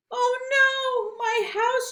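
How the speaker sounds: mock yell. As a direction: cry out over the fallen house. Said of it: "yelled Beaver".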